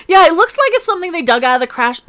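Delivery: ordinary talk, nothing marked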